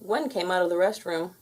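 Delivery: plain reading voice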